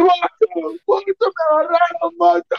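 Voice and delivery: high drawn-out cry for help; mock yell. Caught friend calling to his buddy, mock yell. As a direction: cry out high as if caught, not full volume.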